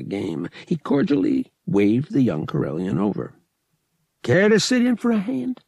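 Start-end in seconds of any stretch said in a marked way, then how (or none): 4.2-5.6 a higher, put-on gambler's voice, cordial; smooth gambler. Lyle inviting Han to the sabacc table, cordial. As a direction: wave him over with easy charm.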